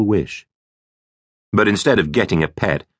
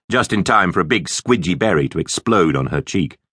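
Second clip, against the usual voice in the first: plain reading voice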